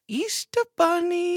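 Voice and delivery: put-on high, drawn-out sing-song child voice; creepy little kid. Mom imitating her daughter in her ear, creepy high child voice. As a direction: creep it out slowly like an eerie toddler. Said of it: "I hear her in my ear".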